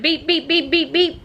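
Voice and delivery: high, even string of beeps; imitating car alarm. Telling Noah what the alarm did, imitated beeping. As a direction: mimic an alarm's repeated high beep. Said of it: "Your car alarm just went".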